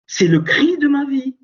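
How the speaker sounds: high, loud cry from the gut. Shown as a demonstration. Preacher crying out to the congregation, loud and high. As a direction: let the cry of your life burst out.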